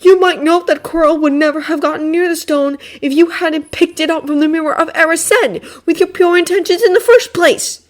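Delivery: high, loud dark tone. Acted. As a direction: say it darkly. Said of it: "Draco said darkly".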